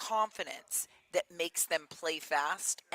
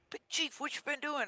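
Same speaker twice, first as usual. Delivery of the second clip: high-pitched retold astonishment; amazed teammates; 9 semitones higher than usual. Teammates watching Chief dominate, amazed. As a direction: imitate players marvelling, pitched well up.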